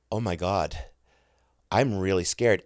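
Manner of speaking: normal talking voice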